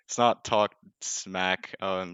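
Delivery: ordinary talk, nothing marked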